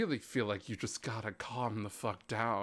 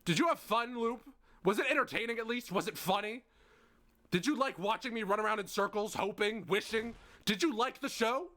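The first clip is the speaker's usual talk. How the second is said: bitter; 8 semitones higher than usual. Acted. Siffrin confronting Loop, high bitter mockery. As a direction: sneer it, hurt.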